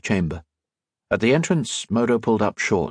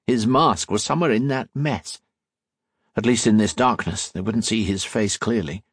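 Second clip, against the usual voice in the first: ordinary delivery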